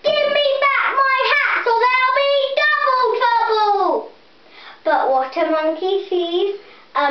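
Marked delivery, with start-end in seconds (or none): 0.0-4.1 high, loud singsong fury; a child's furious hat seller; furious shout. A narrator's reading. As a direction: stamp and bellow at the monkeys. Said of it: "He stamped his feet".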